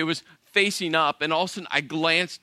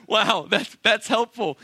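higher, mock-impressed sarcasm; sarcastic; 5 semitones higher, 4 dB louder, slower than usual. Husband reacting to wife's useless landmark, sarcastic. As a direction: feign amazement at a useless answer.

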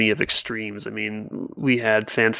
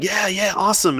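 mock cheering crowd; 9 semitones higher than usual. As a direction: imitate an excited crowd, held back in volume. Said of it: "everyone's in the crowd screaming".

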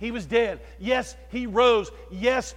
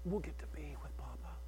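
a soft, breathy whisper of hope; hushed whisper; 7 semitones lower, 17 dB quieter than usual. Real, not acted. Preacher to grieving family, whispered. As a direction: whisper it gently, almost to yourself. Tender hushed line at funeral.

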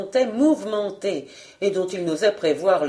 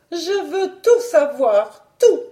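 high, slow, emphatic; imperious slow baroness; insistent demand; 8 semitones higher, 4 dB louder, slower than usual. Acted. Wife insists on knowing all.

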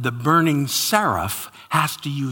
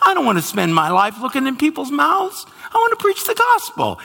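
higher, louder, fast complaining plea; whiny protest; 11 semitones higher, 4 dB louder, faster than usual. Retold or imitated. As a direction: argue back at God like a stubborn kid. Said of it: "And I said".

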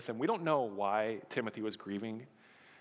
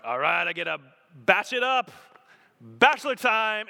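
gleeful higher, louder bachelor cheer; mock glee; 6 semitones higher, 8 dB louder than usual. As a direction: savor the freedom like a kid. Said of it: "which initially I'm like".

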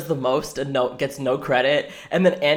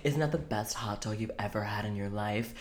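sultry voice; 5 semitones lower, 12 dB quieter than usual. Retold or imitated. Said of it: "weird sultry voice".

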